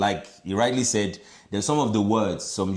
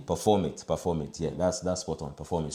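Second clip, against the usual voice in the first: soft repeat; 5 semitones lower, 7 dB quieter than usual. Shown as a demonstration. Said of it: "Don't just do it".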